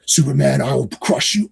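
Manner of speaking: lightly voiced Mongo threatening Superman; menacing villain. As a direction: growl it like a brute villain. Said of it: "Mongo's like".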